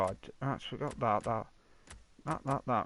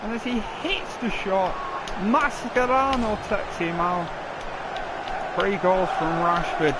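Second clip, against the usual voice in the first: esports caster; 3 semitones higher than usual. Acted. Gamer narrating play like caster.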